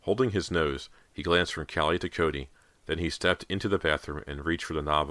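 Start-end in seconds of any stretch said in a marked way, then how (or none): none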